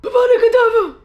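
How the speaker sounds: high, loud laughing spell cast; spell shout. Pretend. Casting a Harry Potter curse at her shelves, shouting. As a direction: yell the curse like a wizard.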